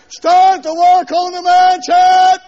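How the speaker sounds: slow, high, loud mock command; God as foreman. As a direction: order the builders back to work. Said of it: "put his name back down there".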